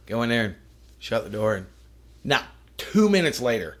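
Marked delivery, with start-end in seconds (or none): none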